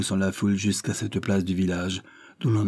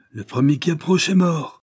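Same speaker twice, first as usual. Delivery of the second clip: threatening shout; 8 semitones higher, 5 dB louder than usual. A narrator's reading. René faces the furious mob.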